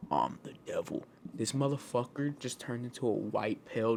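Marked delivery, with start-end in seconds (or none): 0.1-1.1 low, soft, menacing devil. Retold or imitated. Devil in the dream whispering in his face, low and menacing. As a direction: say it low and quiet like evil itself. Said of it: "and he's like".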